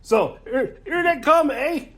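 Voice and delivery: put-on Canadian accent, lifting up on the closing eh; mock Canadian accent. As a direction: play the Dark Elves as cheery Canadians with a Canadian accent. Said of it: "they'd be Canadian".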